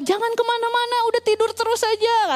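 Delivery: high-pitched mock parental fussing; mimicking over-protective parents; imitated nagging parents. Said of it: "Wah, cerewet semua orang tua".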